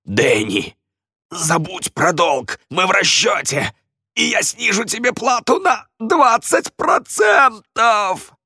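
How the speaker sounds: high, strained, slow shouting; choked, panicky offer; desperate bargaining. Acted. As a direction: plead through a squeezed throat, climbing to a squeal. Редж торгуется, пока его душат.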